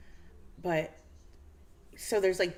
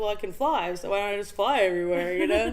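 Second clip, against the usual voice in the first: mock smug newbie; 4 dB louder, faster than usual. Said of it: "He's like".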